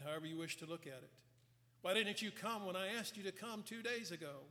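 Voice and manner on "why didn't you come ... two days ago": higher-pitched, mildly reproachful question; hint of accusation. Martha asking Jesus why he didn't come, mildly accusing. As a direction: press the question as a restrained reproach. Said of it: "a hint of accusation in that".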